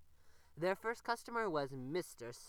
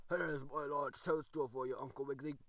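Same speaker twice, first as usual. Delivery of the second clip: grunting frog; 3 semitones lower, 3 dB louder than usual. A narrator's reading. The frog offers the rabbit his toadstool, grunting. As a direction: grunt it out like a friendly old frog. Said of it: "grunted Mister Croaker".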